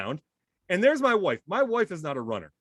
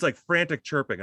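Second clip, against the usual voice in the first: ordinary talk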